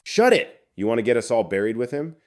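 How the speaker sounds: sharp, high, loud hushing bark; angry shout. Acted. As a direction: shut her up fast and furious. Boss silences woman.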